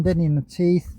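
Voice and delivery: ordinary delivery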